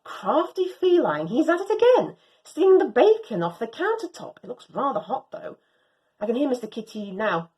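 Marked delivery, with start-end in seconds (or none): none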